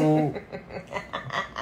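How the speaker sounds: a brief soft laugh; short laugh. Real, not acted. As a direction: laugh lightly mid-sentence. Laughing about stress relief.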